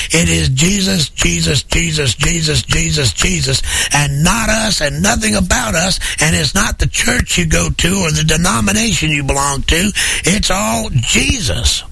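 rising high and fervent on every Jesus; fiery preaching. Acted.

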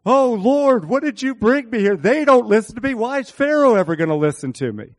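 high, loud pleading lament; whining Moses. Retold or imitated. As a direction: wail your complaint up to God. Moses complaining to God.

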